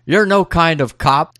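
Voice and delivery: high-pitched mock yelling; mock jeering shout; retold yell. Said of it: "yells at them".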